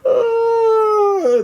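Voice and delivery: long high sung hold; held high note. Acted. Chimichangos at the fiesta, a long high sung note.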